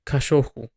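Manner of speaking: "cachorro" with its R said like an h; softened guttural R. Shown as a demonstration. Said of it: "guttural R at the beginning is not as rough".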